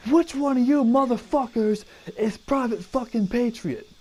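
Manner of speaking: high-pitched, mock-furious sergeant bark; drill sergeant. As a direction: demand to know who it is, like a drill sergeant. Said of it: "Drill sergeant goes".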